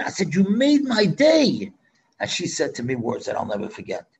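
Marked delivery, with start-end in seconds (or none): 0.3-1.7 much higher, louder, slow, beaming; delighted. Retold or imitated. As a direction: draw out the joy on each word. Rabbi's delighted reply.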